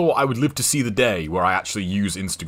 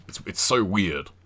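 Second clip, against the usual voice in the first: normal talking voice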